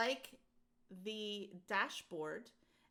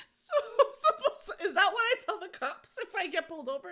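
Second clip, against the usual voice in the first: high, loud laughing question; laughing disbelief; 10 semitones higher, 9 dB louder than usual. Retold or imitated. Asking the clerk about expired registration, high laughing disbelief. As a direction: squeal it through laughter.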